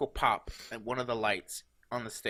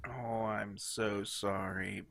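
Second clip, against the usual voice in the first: ordinary delivery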